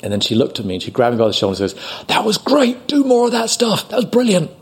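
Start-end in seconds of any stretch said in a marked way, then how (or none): 2.1-4.5 very high-pitched, thrilled urging; excited praise. Retold or imitated. Crew grabbing actor after the scene, excited praise. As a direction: gush with high, eager approval.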